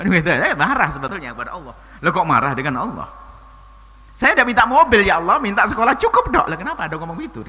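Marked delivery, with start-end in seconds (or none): 4.2-6.5 louder, higher mock protest to God; indignant complaint. Preacher mimics a believer complaining to God, indignant and loud. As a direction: whine it like a believer who feels cheated.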